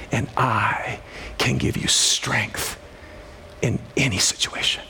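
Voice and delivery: slow, soft and low; soft, slow, hushed reassurance; gentle voice of God. Retold or imitated. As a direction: lean in and offer it tenderly.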